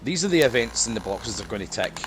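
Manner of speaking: ordinary talk, nothing marked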